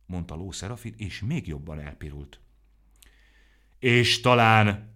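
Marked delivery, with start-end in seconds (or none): 3.8-4.9 slow, loud, drawn-out dramatic opening. A narrator's reading. Aromó dramatically asking Ló Serafin, slow and loud. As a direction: pause on it like a stage villain. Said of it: "drámai hangon".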